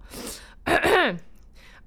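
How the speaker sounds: clearing the throat hard to steady the voice; a sharp throat clear to pull herself together; composing throat clear; loud throat clear. Real, not acted. Composing herself after tears.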